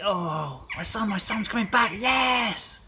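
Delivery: high, loud cry of joy; a father spotting his lost son, thrilled; joyful shout of a father; overjoyed father. Acted. Father sees his returning son.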